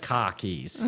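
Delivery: "khakis" drawn out Boston style; light Boston accent imitation. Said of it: "That's the Boston accent".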